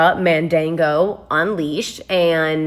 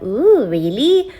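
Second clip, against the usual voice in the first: slow, drawn-out mock interest; sarcastic ooh; 4 semitones higher, 3 dB louder, much slower than usual. Telling about a stranger at the bus stop, slow sarcastic ooh. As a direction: stretch it out, dripping with sarcasm. Reacting to a stranger's creepy line.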